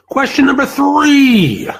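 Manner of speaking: high, slow heading with a long held last word; announcer call. Real, not acted. Host announcing the next question to viewers, called out loudly.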